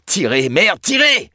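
high, loud yell of command; bellowed order. A narrator's reading. Team leader orders guards to haul the fire hose, bellowing. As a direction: yell it as an urgent, swearing order. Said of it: "Celui qui venait de gueuler".

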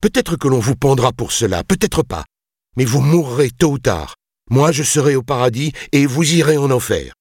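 high, impassioned condemnation; defiant. A narrator's reading. Builder defies the lord. (défi passionné, voix tendue vers l'aigu)

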